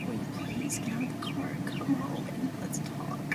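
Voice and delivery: whispered plea; hushed pleading whisper. Real, not acted. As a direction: beg him softly under your breath. Mother pleading with son in car.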